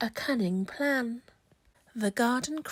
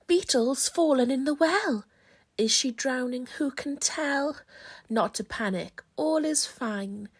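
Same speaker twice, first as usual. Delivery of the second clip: plain reading voice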